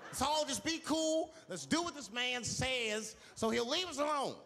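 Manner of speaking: high, slow placating voice; hammed-up nervous peacekeeper, high and slow; mock timid passenger. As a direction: soothe everyone nervously. Timid passenger pleading calm.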